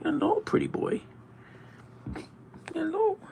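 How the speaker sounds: high, soft cooing to the pet. Real, not acted. Greeting his cat as pretty boy, in a high cooing voice.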